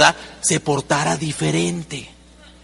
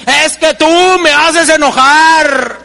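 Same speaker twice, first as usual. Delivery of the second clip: louder, higher, slow, indignant; slow, raised, aggrieved blame; mock angry complaint; 6 semitones higher, 7 dB louder, slower than usual. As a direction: whine it like an offended spouse. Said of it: "Cuando usted y yo decimos".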